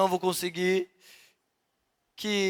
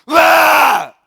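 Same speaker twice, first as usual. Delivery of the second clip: huge lion roar at the mirror, much higher and louder; explosive full-throat lion roar; roaring shout; 9 semitones higher, 14 dB louder than usual. Shown as a demonstration. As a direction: roar it out to scare the tempter off. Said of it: "só faço assim".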